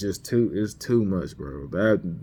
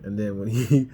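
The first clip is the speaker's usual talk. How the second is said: ordinary delivery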